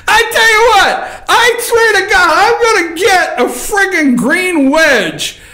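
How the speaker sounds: high-pitched, worked-up exclamation; incredulous outburst. Real, not acted. Host reacting to a million-dollar claim, incredulous outburst. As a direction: erupt in amused disbelief, stretching the end.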